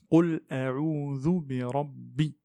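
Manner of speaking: "Qul a'udhu bi rabbi" slow, heavy ra; tajwid model. Shown as a demonstration. Teacher models the verse slowly, ra emphatic. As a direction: read slowly, letter by letter. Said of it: "Le ra ici".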